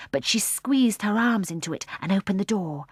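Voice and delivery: plain reading voice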